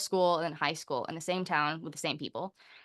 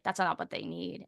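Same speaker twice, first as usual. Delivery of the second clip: usual speaking voice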